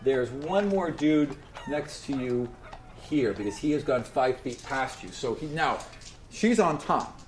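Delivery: ordinary delivery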